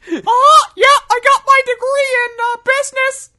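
very high, loud cartoon clown voice; squeaky silly clown shouting. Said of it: "Why'd you do a Mickey Mouse laugh?".

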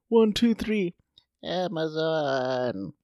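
high, then drawn-out slow Amazon; chanting like a worn-out old worker; old lady voice. Retold or imitated.

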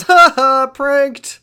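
loud, high gleeful taunt; mock gloating. [name] revealing the prank to her friends, mock gloating. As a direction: crow it with a put-on laugh.

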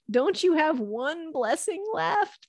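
high, slow mock-tearful begging; weeping plea. Esau begs his father for one blessing, imitated weeping. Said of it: "raises his voice and weeps".

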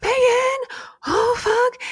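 very high, loud panicked cry for a friend; high, loud yelp of panic; panicked yell. Retold or imitated. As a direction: shriek her name in sudden alarm. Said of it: "I yell out to Megan".

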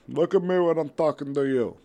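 loud, high angry wrestling promo; Andre the Giant. Acted. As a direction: bellow it at a rival in the ring. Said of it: "Andre the Giant calls out Cactus Jack".

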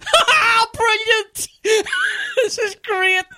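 high, loud giddy whoop; drugged delight. Retold or imitated. Drugged victim loudly cheering his lost limbs. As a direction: crow with drugged glee.

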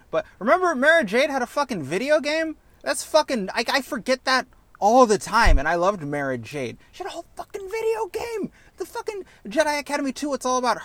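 real excited squeal of fandom. Real, not acted. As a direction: gush like a fan who just remembered. Gushing about a favourite game.

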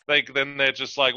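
ordinary talk, nothing marked

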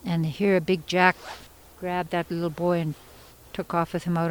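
ordinary delivery

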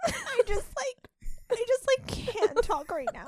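soft, high mock sob breaking up the words; imitation crying. Shown as a demonstration. Showing friends how she cries, pretend crying. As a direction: act too tearful to get words out. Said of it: "what she sounds like while she cries".

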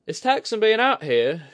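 high, loud figure voice, owning up; candid admission. Acted. Woman admitting strain to her companion, candid. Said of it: "she admitted".

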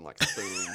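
mock dragon shout.

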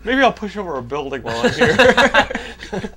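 loud, slow, laughing mischief; laughing villain musing. Pretend. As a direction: tease it like a bored villain. Bored hero turning villain.

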